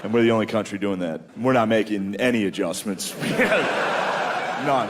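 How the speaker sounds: ordinary delivery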